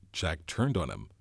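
ordinary delivery

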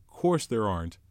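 put-on anxious boy Piggy. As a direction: fret and urge like a scared schoolboy. Piggy answering firmly.

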